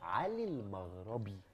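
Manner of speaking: drawn out, each word spelled clear; slow and deliberate. Shown as a demonstration. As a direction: say علي المغربي slowly, word by word. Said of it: "قول، قول".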